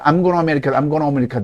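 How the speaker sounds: "gonna" run together, fast and linked; linked speech. Shown as a demonstration. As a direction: blend "I'm gonna America" smoothly. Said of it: "연음으로 하면". (연음으로 빠르게)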